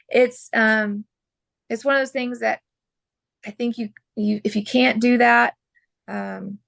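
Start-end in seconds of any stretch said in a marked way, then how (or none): none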